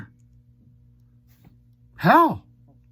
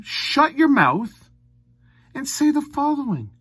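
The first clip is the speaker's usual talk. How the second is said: much higher, sharp and scolding; heated tirade; 10 semitones higher than usual. Real, not acted. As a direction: tell him to shut it. Telling the player off.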